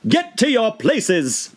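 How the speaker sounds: high, very slow commanding queen; thundering queen. A narrator's reading. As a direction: boom the order over the crowd. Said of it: "in a voice of thunder".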